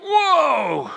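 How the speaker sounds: sudden high loud whoa; startled whoa. Retold or imitated. Opening a hot oven to the congregation, startled high whoa. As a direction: jump back from the blast of heat. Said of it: "you're like".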